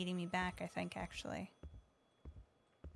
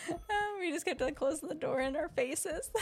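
laughing; 8 semitones higher than usual. Real, not acted. Laughing at door mishap.

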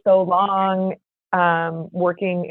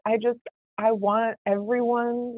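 ordinary talk, nothing marked

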